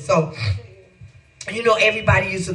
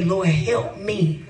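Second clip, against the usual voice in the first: slow prayer; much slower than usual. Shown as a demonstration. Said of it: "that's where we go and say".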